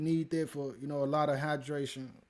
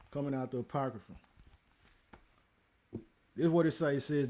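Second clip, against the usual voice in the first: usual speaking voice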